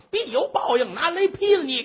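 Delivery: high-pitched stage curse; theatrical, high cry of condemnation; vengeful curse. Acted. Old mother cursing from the roof as thunder strikes, vengeful. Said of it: "这正好那话接着".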